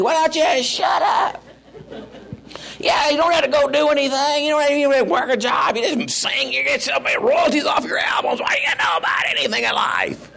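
high, laughing mock rant yelled at the TV; hollering at the TV. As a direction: rant at the singer on TV in a high, half-laughing yell. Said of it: "So I'm hollering at the T V".

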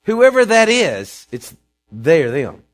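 loud, slow proclamation; preaching at full volume; emphatic shout. Acted. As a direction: slam it home from the pulpit.

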